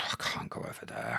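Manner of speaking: breathy near-whisper after a heavy exhale; low, deflated whispered refusal; reluctant sigh. Retold or imitated. Reluctant reply to mate's invitation.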